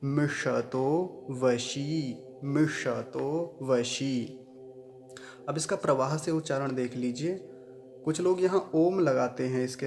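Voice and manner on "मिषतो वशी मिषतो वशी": each word twice, very slow and careful; slow word-by-word drill. Shown as a demonstration. Teacher drills every word twice for students. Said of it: "सभी शब्दों का पुनः उच्चारण देख लीजिए".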